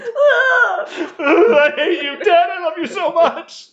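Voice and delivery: very high, loud fake crying wail; angry-sad mock sobbing. Sam to his dad on the phone, mock sobbing. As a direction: wail it as a hammed-up sobbing outburst. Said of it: "doesn't know whether he's angry or sad".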